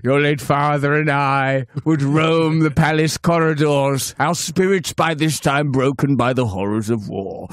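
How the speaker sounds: put-on ponderous old statesman reminiscing; Winston Churchill. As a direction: address the monarch in a mock-grand statesman's voice. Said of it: "That's, uh, Churchill".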